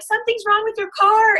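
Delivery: high, lightly voiced bystander's alarm; quoted warning. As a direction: play it up as someone pointing out the car's trouble.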